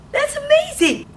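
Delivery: teacher's big amazed squeal; amazed gasp. Acted. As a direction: gasp then a very high "That's amazing!". Preschool teacher praising the letter.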